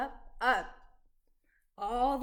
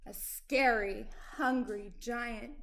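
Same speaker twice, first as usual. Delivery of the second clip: ordinary delivery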